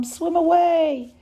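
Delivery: drawn-out pleading yell to flee; imploring shout. A narrator's reading. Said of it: "She implored the other ducklings".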